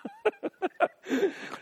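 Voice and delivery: high burst of real laughter. Real, not acted. Laughing at Peter's question.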